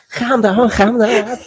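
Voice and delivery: over-the-top Scouse calm down; Scouse accent. Retold or imitated. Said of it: "Scouse accent".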